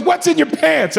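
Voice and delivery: high-pitched, insistent questioning; with an attitude. Retold or imitated. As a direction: imitate a demanding agent. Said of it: "comes at me with an attitude".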